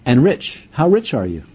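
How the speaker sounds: ordinary talk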